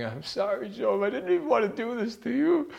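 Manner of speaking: high sobbing parody of a weak God; whining, weeping God. Retold or imitated. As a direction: ridicule a God who whimpers sorry to Job. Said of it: "he's not weeping and crying".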